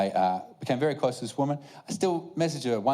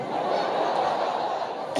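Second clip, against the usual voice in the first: laughter. Real, not acted. Laughing at the story.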